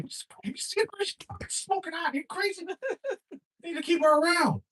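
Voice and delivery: pleading with the TV host; excited pleading. Acted. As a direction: beg like a fanboy. Pleading with Chris Hansen.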